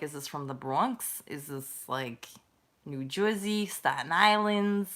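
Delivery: put-on Bronx and Jersey talk; New Jersey accent. Streamer guesses which borough it is, mock New Jersey accent. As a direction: guess aloud in a New York-area accent. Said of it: "from Long Island or New Jersey".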